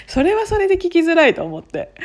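high, loud, giggly delivery; amused and laughing, voice lifted; talking through laughter. Real, not acted. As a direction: keep laughing as you say it. Laughing on about the echo mishap.

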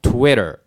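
TWITTER as "TWEH-der" with tapped T and R ending; English pronunciation. Shown as a demonstration. Teacher putting the sounds together, American pronunciation. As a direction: round the lips, then TWITTER smoothly, American-style. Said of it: "最後舌を引いてる".

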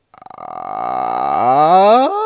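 low creaky edge-voice ah rising at the end; vocal fry. Shown as a demonstration. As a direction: start in a croaky fry, then slide up. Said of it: "一回やってみますと".

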